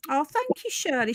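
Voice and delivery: usual speaking voice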